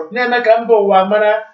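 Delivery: ordinary delivery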